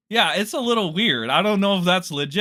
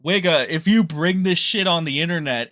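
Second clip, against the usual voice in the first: usual speaking voice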